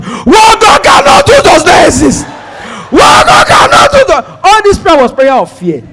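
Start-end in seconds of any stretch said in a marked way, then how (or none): 0.0-4.2 high, loud desperate proclamation; shouting faith. Retold or imitated. Panicked passenger shouting declarations at God, hammed-up yelling. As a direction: yell the faith line over and over. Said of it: "He was just shouting".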